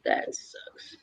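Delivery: whispered aside; soft unvoiced remark; whispering. Real, not acted.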